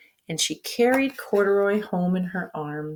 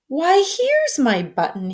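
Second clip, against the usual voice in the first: delighted, raised, slow cry of discovery; cried out; 8 semitones higher, 3 dB louder than usual. A narrator's reading. Teddy bear spots his missing button, slowly and brightly delighted. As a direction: bear finds his lost button, thrilled. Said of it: "he cried".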